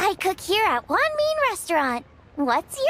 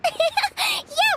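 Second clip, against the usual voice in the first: giggle; 12 semitones higher than usual. Acted. Paimon giggling at her joke.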